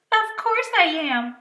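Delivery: sharp, loud, high snap; snapped. Acted. Girl monster Cynthia offended, snapping loudly. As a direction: bite the answer off. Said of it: "she snapped".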